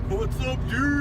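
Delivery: high, loud mock greeting to a pal; loud buddy greeting. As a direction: holler hello at your old buddy. Greeting the statue like a friend.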